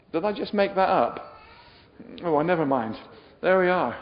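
ordinary talk

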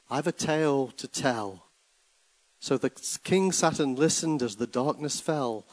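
rhythmic, rising and falling recital; dramatic poem reading. Acted. Preacher reading a poem to church, dramatic. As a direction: read the ballad like a storyteller.

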